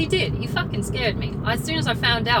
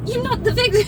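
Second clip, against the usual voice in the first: indignant; 12 semitones higher, 6 dB louder, faster than usual. Real, not acted. Repeats louder, higher.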